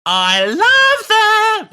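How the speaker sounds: high, long, loud gushing squeal; drawn-out delighted shriek; excited squeal. Real, not acted. Gushing about baby angels.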